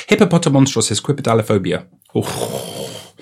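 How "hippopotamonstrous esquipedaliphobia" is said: quick, in one smooth run; fluent rattle-off. Real, not acted. Nailing the retake of a long word, fluent and quick. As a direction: rattle off "Hippopotamonstrous esquipedaliphobia" without a break.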